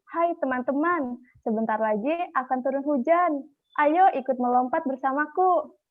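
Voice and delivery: high, bouncy frog calling to friends; cheerful frog voice. Acted. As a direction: call to your friends as a happy little frog. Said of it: "berteriak dan bernyanyi".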